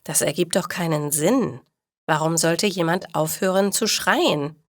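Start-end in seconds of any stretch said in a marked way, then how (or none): none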